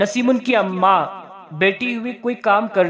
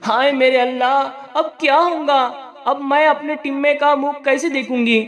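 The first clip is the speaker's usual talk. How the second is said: wailing lament; 6 semitones higher than usual. Acted. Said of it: "नसीबुन सीने पर हाथ मारती हुए".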